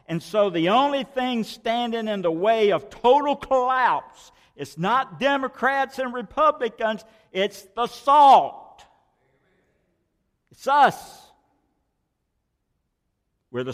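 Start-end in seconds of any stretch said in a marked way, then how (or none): 0.1-11.2 fiery sermon peak; preaching at full force. Acted. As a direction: rise to a preacher's cry. Preaching climax against collapse.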